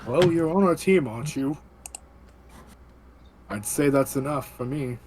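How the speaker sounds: normal talking voice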